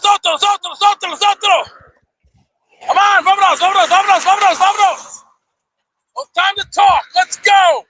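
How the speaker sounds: high-pitched, insistent hollering; urgent calling out. Acted. Driver hurrying everybody along in English and Spanish, high urgent shouts. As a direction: keep yelling short orders high up, hurrying them along. Urging everybody to get moving.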